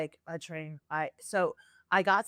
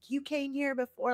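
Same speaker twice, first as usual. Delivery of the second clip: higher-pitched mild imitation of a mad friend; retold mad friend; 6 semitones higher than usual. Friend scolding her at the cemetery, mildly mad imitation. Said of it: "my friend was so mad at me".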